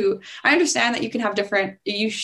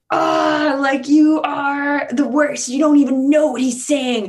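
mock angry fan; 4 semitones higher, 4 dB louder, slower than usual. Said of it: "But people are like".

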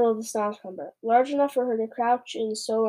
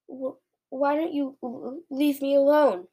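higher-pitched, stuttering plea; nervous stammer; 4 semitones higher than usual. A narrator's reading. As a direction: stumble nervously into a timid protest. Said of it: "he stammered".

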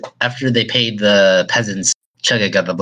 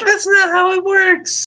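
much higher, slow protest; high squeaky protest. Real, not acted. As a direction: squeak out the denial. Arguing magic rules.

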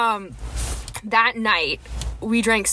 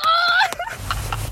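mock squeal, very high; high-pitched squeal. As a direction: let out a high retold "ah". Retelling her reaction to him smiling.

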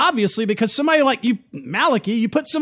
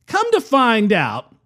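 normal talking voice

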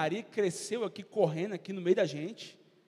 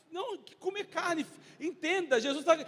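higher, indignant mock crowd protest; confused crowd; 8 semitones higher, 4 dB louder than usual. Preacher mocking the crowd's confusion, higher and indignant. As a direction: play the people who took it literally.

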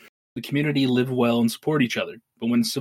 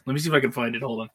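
ordinary delivery

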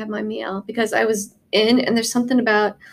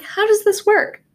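amazed; 11 semitones higher, 3 dB louder than usual. Retold or imitated. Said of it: "I'm like".

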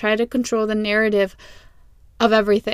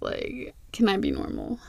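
whispering; 3 semitones lower, 12 dB quieter than usual. Real, not acted. Self-conscious aside after laughing.

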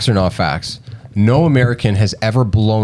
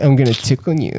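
teasing voice; 2 semitones higher than usual. Pretend. Playing tickle monster.

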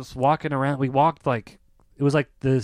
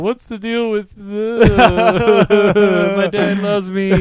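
lightly voiced stand-up bit, high and very slow; a hint of a cheesy comic's opener; pretending to be the comic onstage, laughing; mock stand-up comic; 4 semitones higher, 4 dB louder, much slower than usual. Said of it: "He come out".

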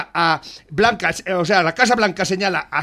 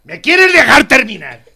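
louder, slower, fed up; exasperated repeat; 8 dB louder than usual. Real, not acted. Host repeats demand to finish, louder and slower. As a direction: spell it out, losing patience. Host cut off in radio argument.

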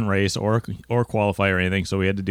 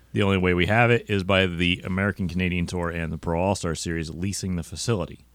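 normal talking voice

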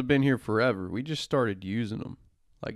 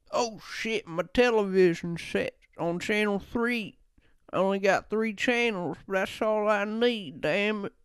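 much higher, hammed-up 1940s voice; old-timey guy; 10 semitones higher than usual. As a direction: play a giddy guy from back then. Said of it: "guys used to talk like that".

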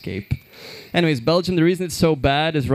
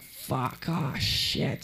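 muttered curse; 9 dB quieter, much slower than usual. Retold or imitated. Caught without mask, muttered.

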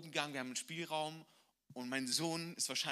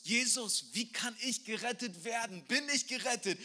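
high, louder urgent question; pleading Nicodemus; 8 semitones higher, 5 dB louder than usual. Retold or imitated. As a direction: ask like a desperate seeker. Nicodemus asking Jesus.

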